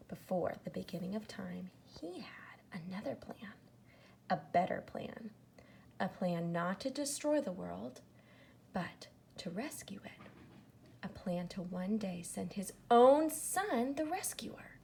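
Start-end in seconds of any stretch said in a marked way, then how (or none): none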